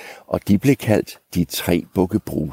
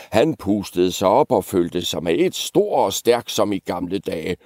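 plain reading voice

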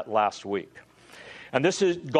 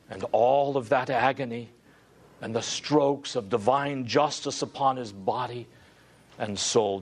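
ordinary talk

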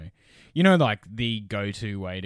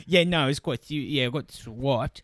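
imitating driver; 2 semitones higher, 3 dB louder than usual. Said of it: "He's like".

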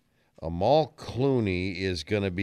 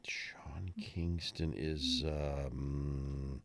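thinking whisper; 7 semitones lower, 10 dB quieter, much slower than usual. Real, not acted. Guessing age, thinking aloud.

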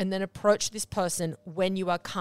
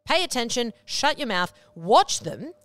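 ordinary talk, nothing marked

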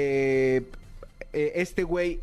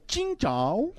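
high, sung rising ending; mock Chinese accent; 8 semitones higher than usual. Demonstrating joke Chinese to listeners, mock Chinese sing-song. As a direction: swoop up at the end in fake Chinese. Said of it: "Es importante el cantado al final".

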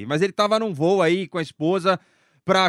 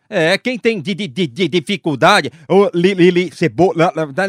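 mock stutter; 4 semitones lower, 6 dB louder than usual. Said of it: "né, língua presa".

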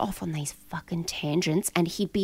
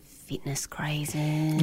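whisper; 3 semitones lower, 4 dB quieter, much slower than usual. Acted. Announcing the lucky dip topic.

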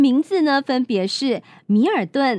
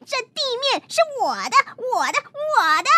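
mock yell; 10 semitones higher than usual. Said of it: "鲁伯特大叫".

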